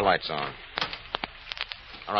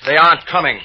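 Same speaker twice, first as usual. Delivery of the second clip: louder, higher cold threat; menacing taunt; 7 semitones higher, 10 dB louder than usual. Acted. Gunman tells captive no rescue is coming, menacing. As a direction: crush his last hope out loud.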